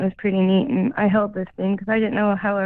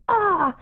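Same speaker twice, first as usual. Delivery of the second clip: high little seagull squawk; a playful bird caw; imitated bird cry; 9 semitones higher than usual. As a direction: mimic the bird calling back. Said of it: "And the bird goes".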